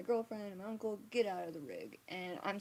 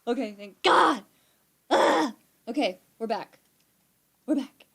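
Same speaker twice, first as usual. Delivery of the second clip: much louder, higher burst of relief; relieved excitement; 5 semitones higher, 15 dB louder than usual. Real, not acted. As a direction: cheer that the stream came back. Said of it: "Okay, good, it didn't".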